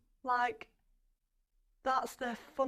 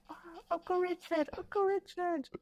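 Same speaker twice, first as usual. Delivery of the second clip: put-on higher, pleading little-boy voice; excited child calling; 8 semitones higher, 4 dB quieter than usual. Little boy begging his uncle for play, higher pleading. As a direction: call out like an eager child.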